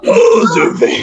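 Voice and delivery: very high, airy, drawn-out angel; mock-angelic falsetto, stretched out; angel's voice. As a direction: put on a hammed-up angelic voice. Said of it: "Angel's voice".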